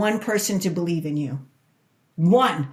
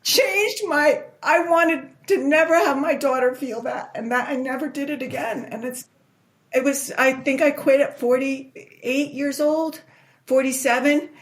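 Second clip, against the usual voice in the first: high, tearful voice fighting through the memory; barely holding it together as she remembers her daughter; choked up; 6 semitones higher than usual. Real, not acted. Recalling daughter's imagined words, emotional.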